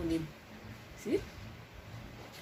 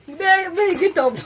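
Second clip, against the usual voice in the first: excited pleading; 9 semitones higher, 5 dB louder, slower than usual. Real, not acted. Pleading through spicy heat.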